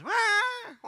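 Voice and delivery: drawn-out mock infant wail; imitated baby cry. Preacher imitating baby Moses crying, drawn-out wail. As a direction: wail "wah" like a newborn, in two bursts. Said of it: "I hear Moses".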